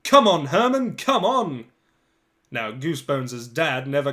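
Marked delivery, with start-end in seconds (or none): 0.0-1.7 loud, high sideline shout from the dad; excited yell. Acted. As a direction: yell it across the pitch like an overexcited dad. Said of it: "but very stupidly yells".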